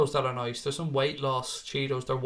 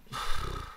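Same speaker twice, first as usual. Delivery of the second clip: long exhaled sigh; stumped sigh; 4 dB quieter than usual. Real, not acted. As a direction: sigh before a hard question. Stumped by a viewer's question.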